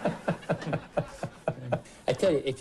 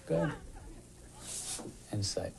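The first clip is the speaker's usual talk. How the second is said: usual speaking voice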